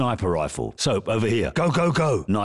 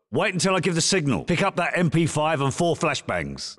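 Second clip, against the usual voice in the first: ordinary delivery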